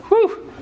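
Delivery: big high exhale of a man done with his day; tired whew. Retold or imitated. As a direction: blow out a weary "whew". Worn-out man home from work.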